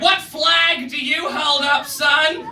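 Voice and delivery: high, slow mock shout; hammed-up prying question, stretched out; nosy woman asking. Fan quizzing the comedian, high and slow. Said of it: "then she was like".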